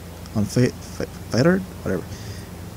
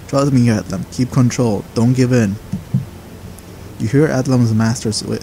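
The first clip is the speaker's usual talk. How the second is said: ordinary talk, nothing marked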